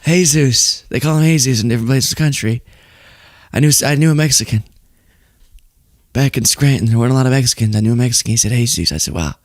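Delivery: low, meandering politician parody; Biden. Retold or imitated. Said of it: "you'd have Biden being like".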